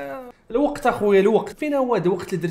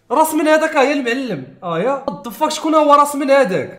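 high, loud startled questioning; shocked disbelief; 7 semitones higher, 6 dB louder than usual. Real, not acted. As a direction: demand it loudly in high-pitched shock. Shocked at severed head on cover.

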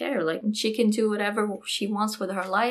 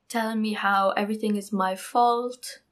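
normal talking voice

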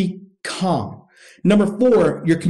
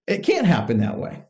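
usual speaking voice

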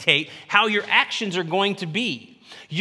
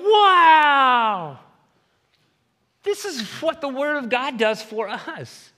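high, loud amazed "Wow" then easing; 7 semitones higher, 4 dB louder, slower than usual. Retold or imitated. Preacher voices a believer amazed by God's word, with a high wow. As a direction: marvel out loud at what you found. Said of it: "And then you go".

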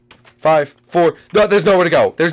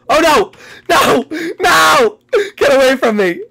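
panicked scream; 8 semitones higher, 5 dB louder than usual. Real, not acted. Seeker chasing him in game.

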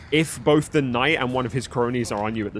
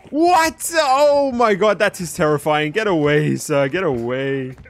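terrified shout; 6 dB louder, slower than usual. Real, not acted. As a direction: yell in real fright. Calls the killer terrifying.

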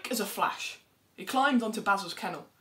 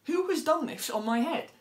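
indignant little mole; 7 semitones higher, 3 dB louder than usual. Acted. Mole asks who soiled him.

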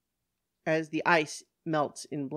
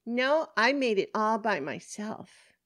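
put-on higher child voice, pleased with herself; proud little girl; 6 semitones higher than usual. Costumed girl boasting to policeman, proud child voice. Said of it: "Bloodbeak says proudly".

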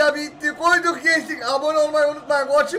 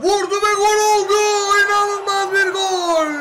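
excited goal call; 7 semitones higher, 4 dB louder than usual. Acted. Calling a second goal.

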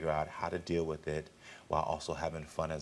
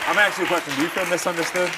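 raised, much higher challenging voice; loud and high; 12 semitones higher, 11 dB louder, faster than usual. Real, not acted. Host loudly confronts teen about feeling misunderstood. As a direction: call her out at full volume.

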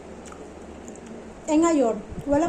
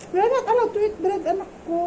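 put-on gentle baby talk; 7 semitones higher than usual. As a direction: tell the baby no, sweetly and lightly. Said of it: "sabi niya".

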